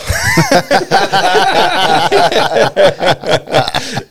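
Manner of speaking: cracking up at the punchline; loud burst of laughter. Real, not acted. Teller cracking up at brother's line, loud laugh.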